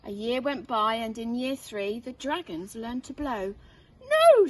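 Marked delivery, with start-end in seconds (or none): 4.0-4.5 high loud stern no; teacher scolding a mistake; sharp teacher no. Acted. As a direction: stop the pupil at once. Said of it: "said Madam Dragon".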